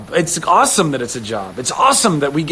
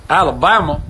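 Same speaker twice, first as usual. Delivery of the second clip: put-on Alabama accent; 4 dB louder than usual. Quoting a favourite film for viewers, Alabama accent. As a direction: call it out in an Alabama twang. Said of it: "Oh, man, Forrest Gump".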